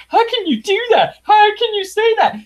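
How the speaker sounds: very high, squeaky mock outrage; mock shocked characters. Reviewer mocking the heroes' shocked reactions, very high mock voice. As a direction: gasp it like a scandalised prude. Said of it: "They're like".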